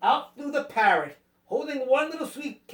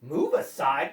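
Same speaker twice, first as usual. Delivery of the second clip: low, dropped-pitch echo; incredulous cat; 7 semitones lower than usual. A narrator's reading. Cat echoing the land crabs, low and incredulous. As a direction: repeat it low, in disbelief. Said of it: "cried the cat".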